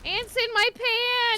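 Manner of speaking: loud high slow squeal; hammed-up squeal. Real, not acted. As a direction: squeal it out like a cartoon. Joking panic over ants.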